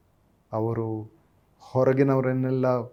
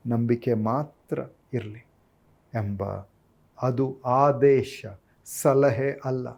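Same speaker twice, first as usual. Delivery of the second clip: ordinary talk, nothing marked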